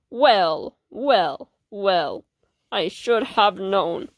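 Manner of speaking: low, slow gloating villain voice. Acted. Villain cornering the heroes, low gloating voice. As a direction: savour the catch like a smug villain.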